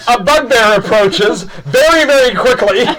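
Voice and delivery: high, drawn-out words through laughter; laughing narration. Real, not acted. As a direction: narrate while cracking up.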